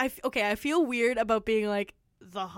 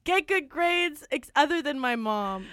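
ordinary delivery

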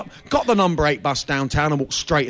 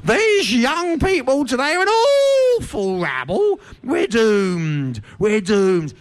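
put-on much higher, slow, scandalised old grumbler; disapproving elder; 5 semitones higher, slower than usual. Comic voicing disapproving proto-human elders, high and scandalised. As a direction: wail it like a tutting ancestor. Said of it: "disapproving elders ... going".

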